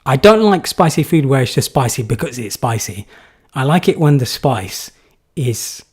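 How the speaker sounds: normal talking voice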